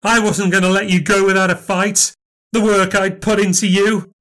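furious grievance. Acted. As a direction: pour out a lifetime of betrayal. Long furious marital grievance.

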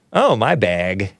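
usual speaking voice

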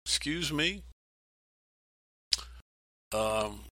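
a doubtful person backing away from God's request; hesitant believer. Retold or imitated. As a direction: shrink back from a big calling. Voicing our reluctant reply to God.

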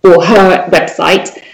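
ordinary talk, nothing marked

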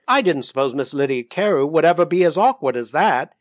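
usual speaking voice

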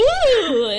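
high, loud sung "ooh" laugh; squealed musical hoot; musical mock laugh. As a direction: imitate a trilling laugh. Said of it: "You go, 'Ooh!'".

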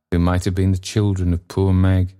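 ordinary delivery

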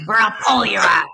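loud rough biker growl; growling tough guinea pig. Acted. Guinea pig threatens an unseen Fluffy, growled loud. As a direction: growl it like a chain-smoking tough guy. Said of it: "he growled".

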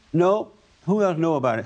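ordinary talk

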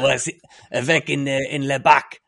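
loud, hammed-up French accent; fumbling mock-French with a heavy accent; bad French accent. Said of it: "I'm gonna do a bad accent now".

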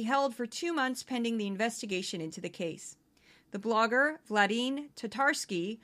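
lightly voiced, even headline reading; news read. Podcast host reads stories Fox News ignored, news-read style. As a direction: read the headlines straight and steady.

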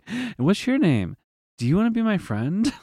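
put-on high, sweet little girl asking. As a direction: chirp it like a friendly toddler. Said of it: "so good about, like".

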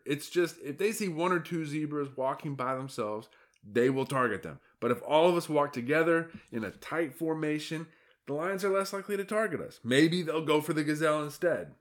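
a fretful zebra pleading to be heard; exasperated herd lookout; alarmed zebra. Acted. Hector explains plan.

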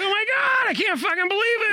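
put-on a drunk Bostonian yelling in disbelief; Boston accent. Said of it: "the Boston accent of the world".

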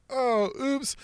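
high, soft oops; coy high oops; mock oops. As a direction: fake innocent little slip.

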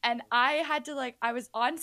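normal talking voice

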